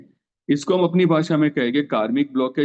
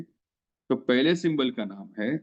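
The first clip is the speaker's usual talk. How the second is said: usual speaking voice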